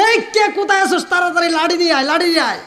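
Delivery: high urgent call, falling off at the end; alarmed summons. Acted. Abu Jahl calls his men to bring sticks, alarmed high call.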